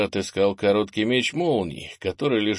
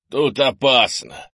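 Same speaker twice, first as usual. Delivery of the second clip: slow, strained warning, louder and higher; hoarse croak; 4 semitones higher, 6 dB louder, slower than usual. A narrator's reading. Injured grandfather who cannot shout warns his grandson, croaking. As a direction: croak it with effort. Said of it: "прохрипел Крис".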